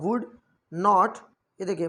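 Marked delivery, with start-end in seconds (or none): 0.0-0.2 would said as wood with the l silent; would without any l sound; teacher showing a silent letter. Shown as a demonstration. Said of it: "एल silent".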